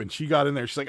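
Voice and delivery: normal talking voice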